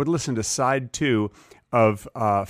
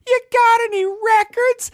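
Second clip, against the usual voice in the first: put-on high, slow little kid asking; 6 dB louder than usual. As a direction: stretch it out like an eager young kid, way up high. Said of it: "'Cause that's how I talked when I was a kid".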